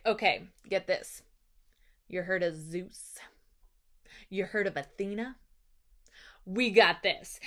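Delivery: low, soft, with long pauses; cool confidence. Retold or imitated. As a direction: let each point land quietly, sure of yourself. Odysseus confides his secret backing.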